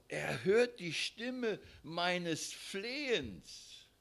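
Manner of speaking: hört stretched, very slow verse; stress on hört. Acted. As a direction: dwell on every word. Repeated key verse.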